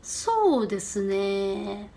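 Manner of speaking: low, soft, very drawn-out 소 데스네; buy time by stretching every syllable; stalling to think. Shown as a demonstration. Interviewee considering a question, slowly pondering. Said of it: "생각하면서 시간 끌면서".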